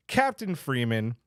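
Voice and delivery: ordinary talk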